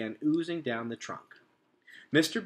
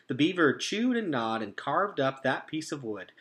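plain reading voice

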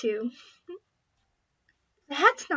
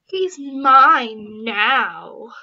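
very slow, loud gloating; mean girl gloating over stolen boyfriend; 3 semitones lower, 11 dB louder, much slower than usual. Acted. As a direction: drag it out to rub it in.

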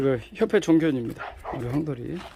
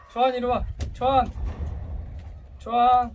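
calling a dog; 6 semitones higher than usual. Real, not acted.

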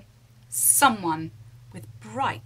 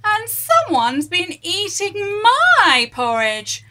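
rumbling Mummy Bear; 9 semitones higher, 10 dB louder than usual. Acted. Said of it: "rumbled Mummy Bear".

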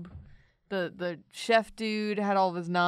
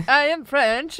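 mock French accent; 5 semitones higher, 9 dB louder than usual. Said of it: "tries to do a French accent".

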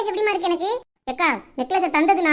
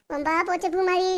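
ordinary talk

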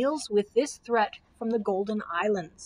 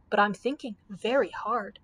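ordinary delivery, nothing marked

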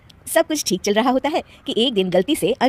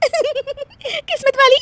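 slow, high, laughing it out; can't keep a straight face; laughing question; 3 dB louder, much slower than usual. Acted. Bride repeating the aunt's praise, laughing.

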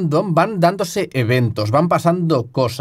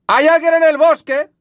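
much higher, louder alarmed cry; startled shout; 13 semitones higher, 4 dB louder than usual. Real, not acted. Spotting someone in the forest, startled shout. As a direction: blurt it out in fright.

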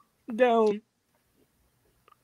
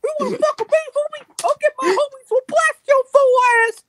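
very high, loud little gangster; Mickey Mouse; 10 dB louder than usual. Acted. Gangster warning that the homies are coming, high mouse voice. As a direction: threaten like a squeaky cartoon gangster. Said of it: "gangster midget with a Mickey Mouse voice".

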